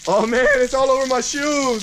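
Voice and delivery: high yelping cry of dismay; real surprise, yelped high. Real, not acted. Drink spilled on shoes.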